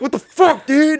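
high-pitched imitation of a shocked friend; mock indignant yelp; Andrew. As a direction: do your startled buddy, pitched well up. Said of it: "he said it in the way".

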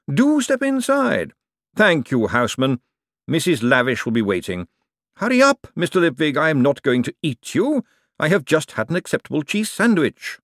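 lightly voiced commanding lord, briskly courteous; a hint of an imperious patrician; urbane ruler. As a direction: issue orders with dry politeness, lightly in character.

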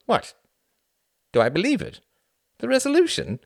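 high, loud incredulous echo; taken aback. A narrator's reading. Reginald startled by Tom's question, incredulous. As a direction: throw the question back, pitch leaping up. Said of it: "Reginald's eyes widened".

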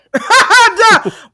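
a burst of high, loud laughter. Real, not acted. Laughing at her own rant.

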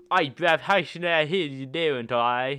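loud, slow northern British caricature, barely understandable; deep north British accent. Acted. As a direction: put on a thick northern English accent and belt it out. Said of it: "a British guy from the deep north".

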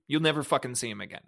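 normal talking voice

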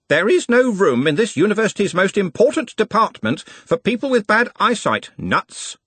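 put-on a touch of a pompous old master, rebuking. A narrator's reading. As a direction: lecture the boy from above, stiffly. Master scolds apprentice.

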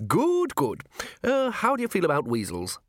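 put-on high, eager old official; fussy old herald. Herald offering Vimes heraldic animals, high fussy voice. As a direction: put the question brightly, pitched high.

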